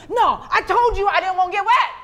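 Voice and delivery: high, loud, furious complaint; angry protest. Retold or imitated. Nigel yells at the youth leaders, furious protest. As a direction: yell that you warned them.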